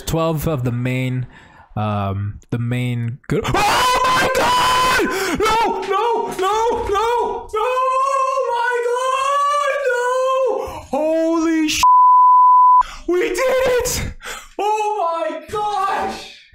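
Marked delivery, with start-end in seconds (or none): 3.5-11.0 real shriek of joy at the pack; ecstatic screaming. Real, not acted. Streamer screaming at his lucky pack, ecstatic. Pack opening, huge hit. 11.0-16.4 high, loud joyful yells; excited yelling. Real, not acted. Streamer celebrating the pack on stream, yelling with joy.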